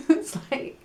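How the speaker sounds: a quick laugh at her own joke. Real, not acted. Teacher laughs at the English pronunciation. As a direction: laugh through the words. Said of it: "it's like you're gonna pour some liquid key".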